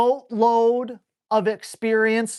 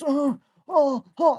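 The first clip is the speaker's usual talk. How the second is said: imitated high dog whine; high-pitched pestering "oh, oh"; whining dog; 5 semitones higher than usual. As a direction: whimper like a needy dog.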